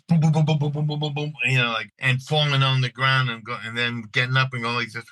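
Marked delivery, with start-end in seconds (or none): 0.1-1.3 rapid-fire run of booms, higher pitched; imitated gunfire. Actor shows interviewer his shot-at audition reaction, imitated gunfire. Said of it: "I would just start going like".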